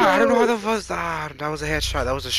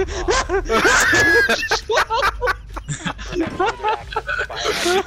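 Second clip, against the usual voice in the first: high falling laugh; laughing fit; 10 semitones higher than usual. Real, not acted. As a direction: collapse into laughter.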